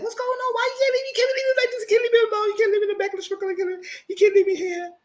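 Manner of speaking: very high, hammed-up panicked begging; exaggerated high-pitched plea, tumbling out; mock frantic pleading. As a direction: beg in a squealing imitation of panic. Acting out Diana begging not left.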